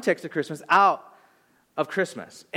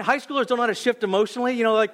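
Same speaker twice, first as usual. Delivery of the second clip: ordinary talk, nothing marked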